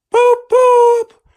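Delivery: very high, very loud honking horn; big engine horn boop. A narrator's reading. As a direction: blast it like a train horn. Gordon's horn voiced.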